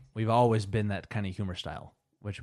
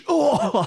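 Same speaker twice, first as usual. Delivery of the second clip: loud, high "oh" breaking into laughter; mock impact cry; 10 dB louder than usual. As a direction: act out a head bonk, laughing. Acts out hitting the chandelier, laughing.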